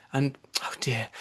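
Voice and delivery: a soft unvoiced sigh of words; whispered dismay. A narrator's reading. Alice whispering her dismay at the muddle. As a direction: breathe it out in quiet dismay.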